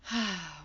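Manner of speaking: short falling sigh; audible sigh. Shown as a demonstration. Teacher moving class on to the next movement, short sigh. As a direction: let out a brief open sigh. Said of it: "exhaling out of the mouth with an audible".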